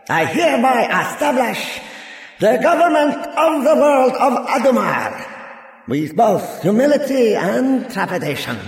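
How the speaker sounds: high, grand proclamation building to a loud peak; orating ruler. Acted. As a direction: declare it to the whole assembly. Parator proclaims world government.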